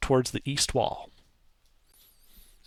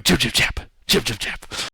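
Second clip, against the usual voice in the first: gnomish gibberish; 2 semitones lower than usual. Acted. Gnomes gesturing at the cart, babbling gnome chatter. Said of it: "gesturing at the cart and at you and going".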